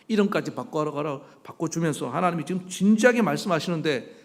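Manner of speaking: ordinary talk, nothing marked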